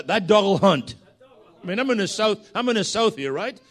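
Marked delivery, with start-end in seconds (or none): 0.0-0.9 Scotsman's loud attempt at a Southern twang; Southern accent. Scottish preacher winning over a Southern congregation, in a Southern accent. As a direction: try your best American Southern accent. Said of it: "do my best Southern accent".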